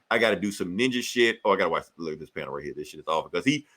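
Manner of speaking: ordinary talk, nothing marked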